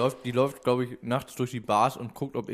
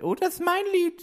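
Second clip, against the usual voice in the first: high-pitched diva voice; [name]. Retold or imitated. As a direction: squeal it like a pop diva. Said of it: "[name] dazu und sagt".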